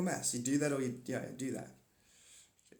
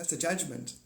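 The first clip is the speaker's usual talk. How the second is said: ordinary talk, nothing marked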